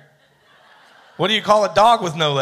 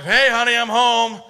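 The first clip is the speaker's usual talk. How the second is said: louder, higher hammed-up drunk hubby greeting; drunk husband calling; 6 semitones higher, 4 dB louder than usual. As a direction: sing it out to the wife like a drunk coming home. Joke: drunk husband calls to wife.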